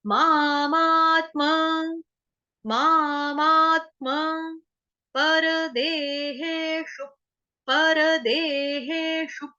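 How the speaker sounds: मामात्मा very slowly, full म then half त; slow syllable demo. Shown as a demonstration. Teacher spelling it out for students, very slow. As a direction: drag out मामात्मा and परदेहेषु syllable by syllable. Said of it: "म हलंत हो जाएगा तो गलत हो जाएगा".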